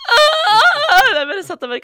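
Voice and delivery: high, loud cry of despair; loud wail of dismay; anguished yell. Retold or imitated. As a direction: wail in mock crisis. Goes into crisis over scar.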